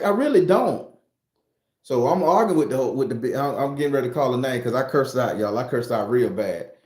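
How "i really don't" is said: sarcastic retort. Retold or imitated. Dealer brushing off the girl's demand, high and raised. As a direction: throw it back at her, pitched up. Arguing with the girl.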